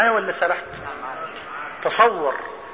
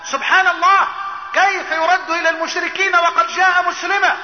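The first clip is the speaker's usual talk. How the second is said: raised-pitch, astonished objection; incredulous protest; 6 semitones higher than usual. Retold or imitated. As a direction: protest in disbelief, voice lifted high. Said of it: "مسلمون الصحابة قالوا".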